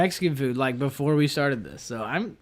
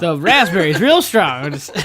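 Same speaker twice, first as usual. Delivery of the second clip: laughing. Real, not acted. Mocking the strawberry mix-up.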